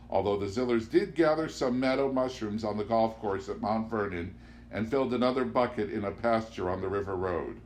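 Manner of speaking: ordinary delivery, nothing marked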